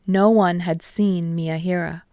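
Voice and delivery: ordinary delivery, nothing marked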